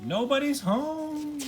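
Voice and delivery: high, slow call through the door. A narrator's reading. Wendy calling to the knock at the door, high and drawn out. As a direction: call out as if nobody is there. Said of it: "Wendy called".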